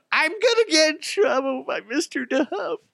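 very high, wailing frightened-child imitation; mock terrified wail. Kid waking from night terrors, hammed-up wail.

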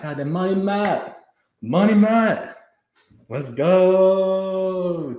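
big excited thanks; hyped shout-out. Real, not acted. Hypes a big donor.

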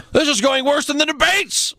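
high-pitched, hammed-up hype voice; mock announcer cry. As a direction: proclaim it in a strained falsetto. Joke continues in put-on voice.

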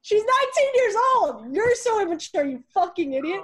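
high, loud exasperated mock yell; mock outraged retort. Retorting to her ex in a high loud mock yell. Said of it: "It's like".

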